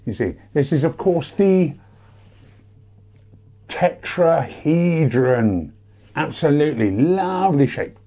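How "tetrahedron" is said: tetrahedron drawn out slowly; slow dramatic reveal. Shown as a demonstration. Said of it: "dramatic reveal".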